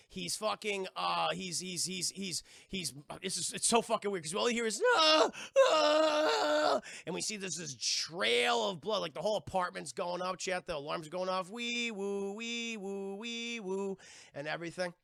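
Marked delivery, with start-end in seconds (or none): 5.0-6.8 high, loud wails; imitated horror victim screams; mock screaming. 11.5-14.0 sing-song two-note siren noise; mouth-made siren wail; imitated alarm siren.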